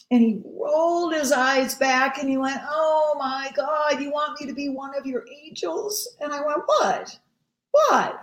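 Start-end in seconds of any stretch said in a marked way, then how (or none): 2.7-6.1 mock disbelief. Brian mocks her request. 6.6-8.2 high, loud double "What?"; startled. Retold or imitated. Pastor stunned by Brian's eye roll, squeaking in shock.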